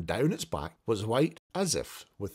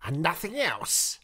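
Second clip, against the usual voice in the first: Scrooge wondering; 6 semitones higher than usual. Acted. Scrooge waiting for the bell.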